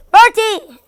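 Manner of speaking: loud, high sharp call of the name. Acted. Sister catches Bertie in the act, shouts his name.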